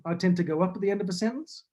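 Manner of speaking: quick line lifting into a questioning rise on "sentence"; New Zealand uptalk, "sentence" rising like a question; Kiwi rising intonation. Shown as a demonstration. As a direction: go up at the end, on "sentence", as Kiwis do. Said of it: "like that. That's kind of what it sounds like".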